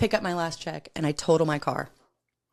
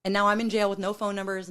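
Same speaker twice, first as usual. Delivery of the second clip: usual speaking voice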